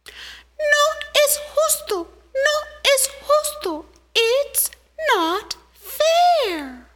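very high, tiny ant voice crying out; angry little ant. Acted. Little ant crying out at the injustice, high squeaky voice. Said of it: "and cried out".

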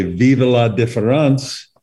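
slow, imperfect French accent; poor French accent. Shown as a demonstration. Host quoting a French saying, in a poor French accent. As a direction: attempt French with an amateur accent. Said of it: "not a very good French accent".

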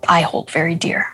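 ordinary talk

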